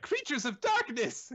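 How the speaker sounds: high, loud, slow cartoon proclamation; hammed-up kids' show host; parody Dora. Dora presenting eldritch horrors, squealed parody. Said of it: "Dora's like".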